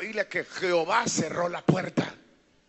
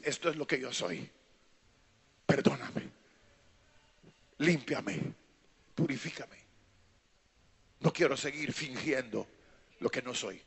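low, soft pleading prayer; hushed confession; 7 semitones lower, 8 dB quieter than usual. Acted. Sinner asking God for forgiveness, in a hushed prayer. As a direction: pray it quietly, with pauses.

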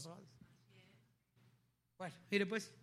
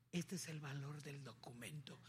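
whisper; 5 semitones lower, 8 dB quieter than usual. Real, not acted. Whispered emphasis.

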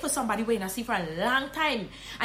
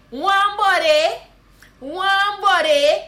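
high, slow, loud Guyanese Creole hail; Guyanese greeting; 6 semitones higher, 8 dB louder, much slower than usual. Shown as a demonstration. Guyanese teacher hailing a buddy loudly. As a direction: shout it slowly to a buddy, Guyanese. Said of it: "you can say".

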